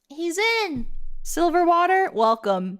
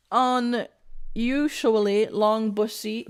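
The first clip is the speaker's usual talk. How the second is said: ordinary delivery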